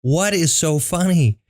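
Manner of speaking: high swoop on FUNNY, drawn out; slow, high-pitched mock bewilderment; confused girlfriend. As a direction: imitate her baffled, exasperated question. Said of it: "she was so confused".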